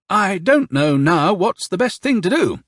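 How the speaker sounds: high, slow, drawn-out colonel; pondering colonel. A narrator's reading. Colonel's dialogue in narration.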